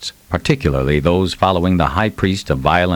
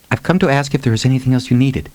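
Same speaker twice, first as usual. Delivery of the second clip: put-on fast, higher, nervous girl; a little quickly; 7 semitones higher, faster than usual. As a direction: rush the words out shyly. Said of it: "she said a little quickly".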